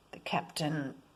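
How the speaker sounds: ordinary delivery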